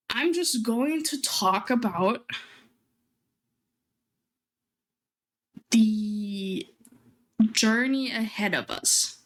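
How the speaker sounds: slow, halting announcement; dramatic. Real, not acted. Streamer introducing big news to viewers, dramatic. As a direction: hold back the news with long pauses. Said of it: "sounds so dramatic".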